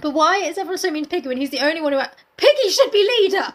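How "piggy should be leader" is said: high, loud proclamation; loud, indignant championing; emphatic declaration. Real, not acted. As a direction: burst out with it like a campaign cry. Declares support for Piggy.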